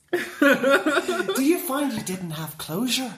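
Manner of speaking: put-on Irish lilt, drawn out; Irish accent. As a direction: pitch it like an Irish salesman, amused. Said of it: "I'm doing an Irish accent".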